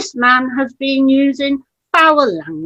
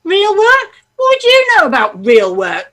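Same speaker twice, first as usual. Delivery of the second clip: indignant; 7 semitones higher, 4 dB louder than usual. Acted. Angry wife mocks husband.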